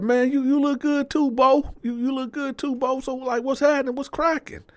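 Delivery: very high, eager delivery; excited greeting. Retold or imitated. Teller greeting Bo back, excited. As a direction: return the compliment with the pitch way up. Returns the compliment.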